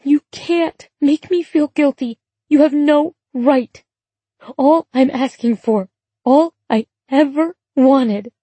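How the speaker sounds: high, slow, weeping plea; tearful. A narrator's reading. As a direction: plead through tears. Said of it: "she wept through the receiver".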